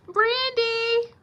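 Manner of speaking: high, sing-out name call; playful high holler; calling out her name. Pretend. Calls out niece's name playfully.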